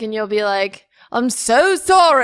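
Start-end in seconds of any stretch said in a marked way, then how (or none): 1.1-2.2 loud, high, slow mock sympathy; hammed-up insincere condolence; disingenuous apology. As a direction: exaggerate a fake apology, drawn out. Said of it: "you say it in a very disingenuous way".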